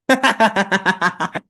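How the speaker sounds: a real laugh at the punchline; hearty laugh. Real, not acted. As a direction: laugh out loud, amused. Laughs at own joke.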